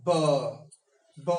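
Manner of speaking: "B" said twice, lips pressed then released; repeated b sound. Shown as a demonstration. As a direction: tight lips, voiced "B" twice. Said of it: "Press your lips tightly".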